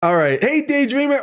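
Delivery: ordinary delivery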